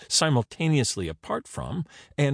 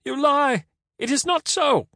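very high, loud protest; shrieked denial; 5 dB louder than usual. A narrator's reading. Caesar denying Render's insult, high outraged shriek.

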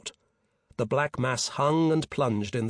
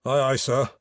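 ordinary delivery

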